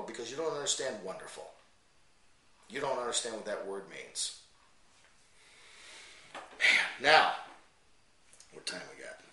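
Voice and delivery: usual speaking voice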